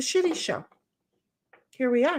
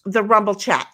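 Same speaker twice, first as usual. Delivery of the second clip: ordinary delivery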